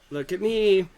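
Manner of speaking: chirpy creature cry; Meeseeks voice. Acted.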